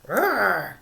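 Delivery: loud, rumbling growl; bear growl. A narrator's reading. Bear choosing jam for his latkes, loud growl. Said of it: "Old Bear growled".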